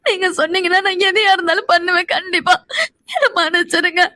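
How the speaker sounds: weepy, desperate promise to do anything; sobbing plea; tearful pleading. Acted. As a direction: beg forgiveness while crying. Crying wife begs forgiveness.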